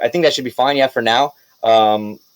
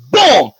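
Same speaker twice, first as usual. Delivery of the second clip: mock boom; 7 semitones higher, 4 dB louder than usual. Narrating the smash.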